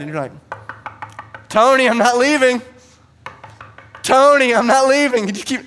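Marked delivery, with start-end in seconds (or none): 1.5-2.6 loud, high holler at the door; stubborn shouted demand; insistent shouting. Acted. Persistent neighbor shouting at door. 4.0-5.3 louder, higher repeated holler; stubborn bellow through a door; insistent shouting. Acted. Persistent neighbor shouting at door.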